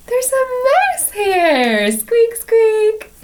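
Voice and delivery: very high squeaky voice; squeaky mouse. Acted. Playing the little mouse for the children, squeaky.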